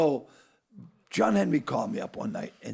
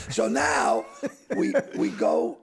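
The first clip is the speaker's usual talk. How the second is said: ordinary talk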